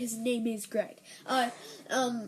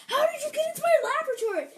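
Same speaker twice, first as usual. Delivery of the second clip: very high, fast, louder startled voice; surprised scientist; 14 semitones higher, 5 dB louder, much faster than usual. Acted. Scientist finding a raccoon in his laboratory, surprised squeal. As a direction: blurt it in high-pitched astonishment. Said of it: "He's sorta surprised".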